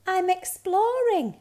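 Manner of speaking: high, loud little cub voice; little bear cub. A narrator's reading. Bear cub tells reindeer, high and bright-eyed. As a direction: announce it proudly in a high cub voice.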